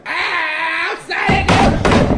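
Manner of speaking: very high, loud yell of outside; furious shout. Retold or imitated. Toothless old-timer throwing the newcomer out, enraged shout. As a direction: throw him out at full blast. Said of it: "The guy has a fit".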